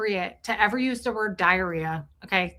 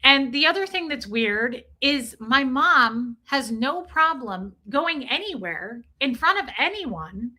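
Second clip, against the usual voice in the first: ordinary talk, nothing marked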